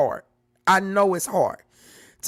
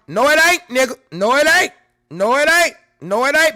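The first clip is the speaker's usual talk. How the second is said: loud, higher, repeated denial; loud rebuttal; 6 semitones higher, 7 dB louder than usual. Real, not acted. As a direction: shout them down again and again.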